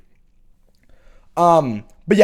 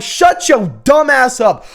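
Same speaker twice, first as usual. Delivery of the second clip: loud, higher, slow shout; yelling back; 8 semitones higher, 5 dB louder, slower than usual. Retold or imitated. Retelling shouting down a Tennessee fan. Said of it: "I'm like".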